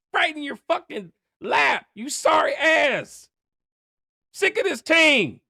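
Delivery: high, loud, building to a yell; screaming at the player, rising to a shout; furious shout. Real, not acted. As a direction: yell it at him in disgust.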